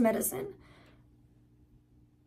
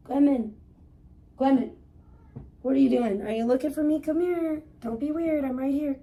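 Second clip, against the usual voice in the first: raised, coaxing pet-talk voice; calling the cat; 5 semitones higher than usual. Real, not acted. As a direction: call her over gently.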